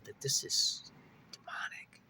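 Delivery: a hushed, secretive whisper. Real, not acted. Telling viewers what the cop sensed, whispered. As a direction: breathe the word out, voiceless. Cop sensing something demonic.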